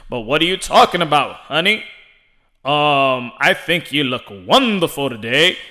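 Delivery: low announcer voice at home; mock announcer sweet talk; Ray Flores. Announcer answering his wife with a compliment, announcer voice. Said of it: "always in an announcing voice".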